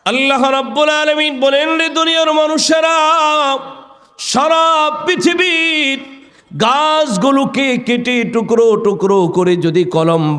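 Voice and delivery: high, ringing sermon chant; preacher's high melodic call; impassioned preaching. Acted. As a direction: proclaim God's words high, easing down at the end.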